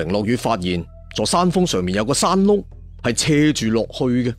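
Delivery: plain reading voice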